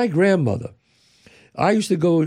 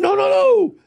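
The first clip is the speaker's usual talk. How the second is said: scream. Retold or imitated. Grandmother yelling at grandson to not touch, imitated scream. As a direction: stop the kid from touching the tray. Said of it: "She screamed".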